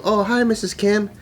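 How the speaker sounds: caught-in-the-act chirpy hello; innocent greeting. Retold or imitated. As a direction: act like nothing's wrong. Caught inside, greeting friend's mom.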